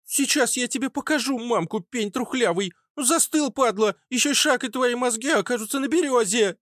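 put-on furious child's squeak, very high; angry cracking treble. Child gunner threatens the intruders, squealing in treble. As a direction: threaten wildly in a shrill treble. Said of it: "Голос сорвался на дискант".

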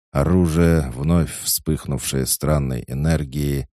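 usual speaking voice

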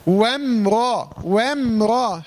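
وامرأ slow, with the hamza dropped in وم; slow recitation demo. Shown as a demonstration. Teacher shows the dropped hamza slowly. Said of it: "بقول وم".

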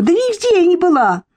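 very high, frantic protest; shrieked denial. A narrator's reading. Irka denies being there that evening, high-pitched cry. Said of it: "закричала Ирка".

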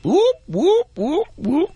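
high squeaky whoops; guinea pig voice. Pretend. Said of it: "Sound like a guinea pig".

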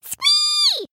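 very high, drawn-out squee; mighty mouse squeal. Acted. Narrator as a mouse leaps from the holder, mighty squeal. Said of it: "with a mighty".

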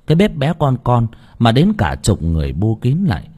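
ordinary delivery

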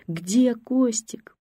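высоко и очень медленно; тяни вопрос на крике; крик. A narrator's reading. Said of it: "продолжала кричать бабушка". (бабушка снова зовёт сына: высокий протяжный крик)

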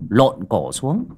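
plain reading voice

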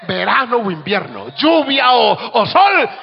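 slow, soaring high shout; preacher's rising cry; preaching peak. Acted. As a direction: stretch it out to a ringing climax. Preaching climax.

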